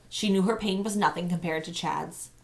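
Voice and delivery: usual speaking voice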